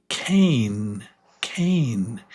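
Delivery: cane with a long "ay". Shown as a demonstration. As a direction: model cane for the class. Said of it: "It's the long vowel sound".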